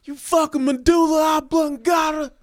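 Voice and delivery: high, loud furious mock shouting; drunk rage. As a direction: throw everybody out of the house. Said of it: "He's like".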